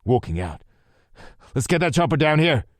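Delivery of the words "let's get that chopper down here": loud, higher called order; urgent order. A narrator's reading. Lou calling to his men for the helicopter. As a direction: call the order out.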